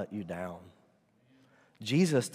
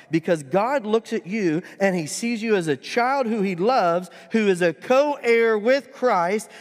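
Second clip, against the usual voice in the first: loud, high, driving sermon climax; full-force preacher's crescendo; impassioned preaching; 9 semitones higher, 8 dB louder than usual. Acted. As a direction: proclaim it to the whole congregation.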